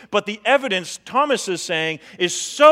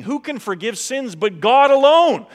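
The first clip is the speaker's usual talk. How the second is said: loud, higher-pitched acted outrage; indignant objection; 6 semitones higher, 8 dB louder than usual. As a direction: raise pitch and volume in indignant challenge.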